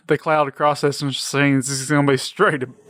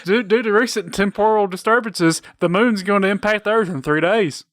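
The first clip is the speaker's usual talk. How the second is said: higher fairy announcer voice; Navi voice; 6 semitones higher than usual. Pretend. Navi warns of moon impact in a higher fairy voice. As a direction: chirp the warning like a fairy.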